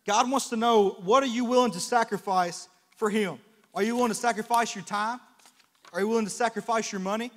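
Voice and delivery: loud, high preaching call; full-force preaching. Acted. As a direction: call out to the congregation.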